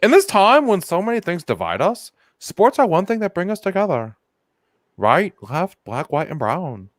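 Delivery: hammed-up Texan speech delivery; Texan accent. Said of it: "statement read in an authentic Texan accent".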